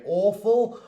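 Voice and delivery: British AWFUL with a rounded "aw" vowel; British accent. Shown as a demonstration. Teacher showing learners the British word, British accent. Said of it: "a British person might say".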